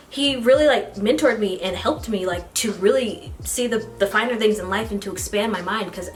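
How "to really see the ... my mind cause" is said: ordinary talk, nothing marked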